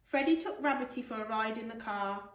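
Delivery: usual speaking voice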